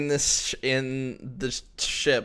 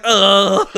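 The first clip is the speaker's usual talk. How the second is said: loud, high-pitched groan; acted loud "ugh"; exasperated groan; 6 semitones higher, 12 dB louder than usual. Acted engineer's exasperated reaction.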